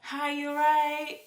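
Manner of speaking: high, drawn-out British greeting; English accent. Acted. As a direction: put on a chirpy English accent, stretched out. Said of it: "I miss the accent, like".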